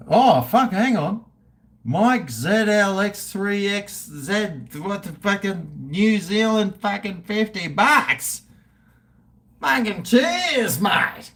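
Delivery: high, loud, stretched-out thanks; high-pitched excited donation shout-out. Real, not acted. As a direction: gush over the donation. Reacting to a fifty-dollar donation.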